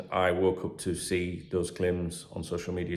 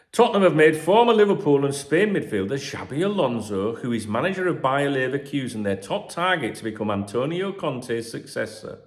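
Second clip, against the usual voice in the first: raised, lively news read; 5 semitones higher, 6 dB louder than usual. Acted. Podcaster reading transfer rumours to listeners, news-read style. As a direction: read the gossip column out like a bulletin.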